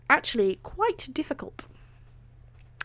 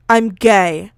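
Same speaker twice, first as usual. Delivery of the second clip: deadpan; 11 dB louder than usual. Acted. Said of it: "in the most deadpan voice he could physically muster".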